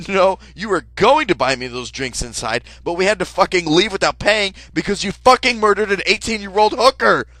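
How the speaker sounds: loud, climbing high furious rant. Retold or imitated. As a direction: build to a yell.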